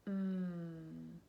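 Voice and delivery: "Mm" as a long closed-lip hum; letter sound demo. Shown as a demonstration. Teacher gives first sound of money, long hummed "Mm". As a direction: hold the M sound alone in "Mm". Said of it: "What sound do you hear at the beginning?".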